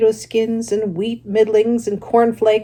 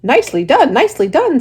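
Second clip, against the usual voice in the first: higher, louder goose praise; the goose praises Wilbur's dodge, high and loud; goose cheering; 7 semitones higher, 7 dB louder than usual. Acted. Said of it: "said the goose".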